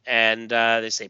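normal talking voice

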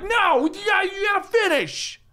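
high whiny fanboy voice; internet voice. Pretend. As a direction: whine like an angry online fan. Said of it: "That's [name]'s internet voice".

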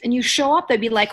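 ordinary talk, nothing marked

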